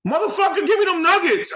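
high-pitched imitation of an irate woman; mock angry outburst. Drive-through girl snapping at someone about nuggets, mock angry. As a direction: yell it high, hammed-up and demanding.

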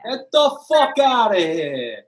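loud, high, drawn-out New York Italian; Italian-American wise guy. Acted. Guest playing along with the hosts, loud Italian-American wise guy. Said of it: "We gotta tap into more of that".

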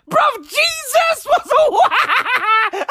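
high yell that dissolves into laughter; laughing shout. Real, not acted. Comic cracking up at his own bit mid-shout. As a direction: break up mid-bellow, slowed down.

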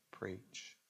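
tiny, low, barely-there voice; said lightly. Shown as a demonstration. Preacher asking how anyone could say preach so lightly, very soft. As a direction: let the word fall softly away. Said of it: "how can you say".

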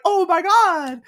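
very high, loud squealed amazement; hammed-up shriek of delight; mock ecstatic. Wife hears fifty thousand dollars, hammed-up ecstatic squeal.

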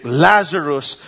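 normal talking voice

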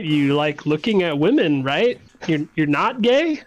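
ordinary delivery

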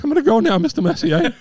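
high, teary-laughing farewell; overwhelmed fan. Retold or imitated. Fan leaving his idol, high overwhelmed squeak. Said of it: "I'm like".

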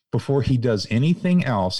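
usual speaking voice

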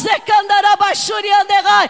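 loud ecstatic speaking in tongues; fervent glossolalia in the sermon; shouted tongues. Acted. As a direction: burst into tongues at full voice. Loud tongues during preaching.

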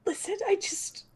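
soft unvoiced whisper. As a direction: lean in and whisper. Whispered start to in-character plea.

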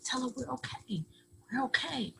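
high, hushed urging, mimicking him; imitated coaxing abuser. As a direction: mimic a man quietly pressing her to cover. Abuser coaxing her, acted out.

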